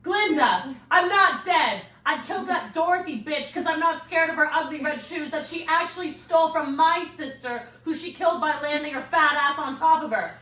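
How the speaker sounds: loud, fast witch's howl. Acted. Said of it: "Elphaba's voice howled".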